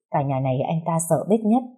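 ordinary delivery, nothing marked

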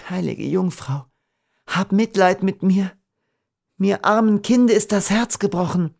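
high, pleading prayer; anguished plea. A narrator's reading. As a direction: beg for pity in a high lament. Heartbroken Beatrice prays for pity.

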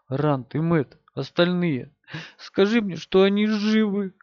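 pleading. A narrator's reading. Girl pleads, pitch rising.

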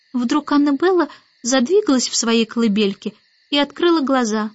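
ordinary delivery

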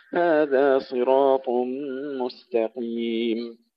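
slow melodic tajweed recitation; Quran recitation. Acted. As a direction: recite the verses with measured chant. (تلاوة مجودة)

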